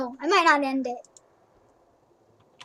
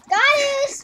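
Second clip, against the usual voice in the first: high call; 9 semitones higher, 3 dB louder than usual. Real, not acted. Calling the cat.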